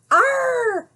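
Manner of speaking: Granny's sudden high cry; a sharp alarmed shriek; startled yell. Acted. Granny shrieking at the wolf at her door. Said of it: "yelled Granny".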